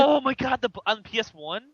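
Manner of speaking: high, loud excited shout; gushing with excitement. Real, not acted. Excited recognition.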